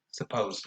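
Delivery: "suppose" very soft, first vowel a slack schwa; relaxed schwa. Shown as a demonstration. Teacher showing how relaxed the schwa in suppose is. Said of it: "Very relaxed".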